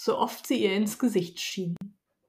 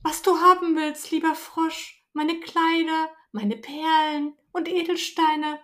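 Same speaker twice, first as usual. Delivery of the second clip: higher voice, offering everything at once; eager princess; 7 semitones higher than usual. Acted. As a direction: bargain eagerly, a spoilt girl. Princess offers her treasures.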